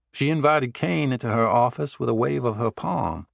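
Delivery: ordinary delivery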